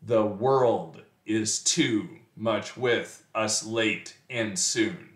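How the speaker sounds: very slow da-DUM beat, stressing "world", "too", "with", "late", "soon"; scanned iambic meter. Shown as a demonstration. Teacher reviewing iambs, slow exaggerated metrical reading. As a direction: tap out "The world is too much with us" in five separate feet. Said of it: "that's a line of iambic pentameter".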